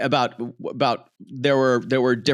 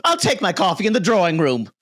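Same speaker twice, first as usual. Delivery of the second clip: higher, fast, grand society lady; haughty lady; 7 semitones higher, faster than usual. Retold or imitated. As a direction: sweep in like a rich matron. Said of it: "she goes".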